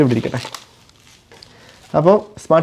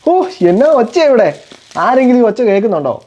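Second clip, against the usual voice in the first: mock surprise; 6 semitones higher than usual. Father mock-surprised at child's noise.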